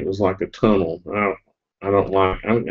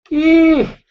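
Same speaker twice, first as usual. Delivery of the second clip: high drowsy mm; long high sleepy mm; sleepy hum; 6 dB louder than usual. Real, not acted. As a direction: hum as if nodding off. Says this is making her sleepy.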